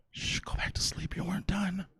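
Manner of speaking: whispered soft and low, mock tender; hushed whisper. Girlfriend hushing him back to sleep, whispered. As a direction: breathe it like soothing someone back to sleep.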